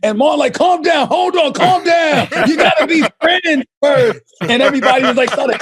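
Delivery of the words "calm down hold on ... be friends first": retold calming shout. Martin calming a couple, retold.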